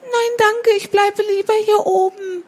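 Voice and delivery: very high, polite little bird voice; well-mannered little sparrow. Acted. Sparrow turns down the cat, high and well-mannered. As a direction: decline politely in a high, small voice. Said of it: "erwiderte der Spatz wohlerzogen".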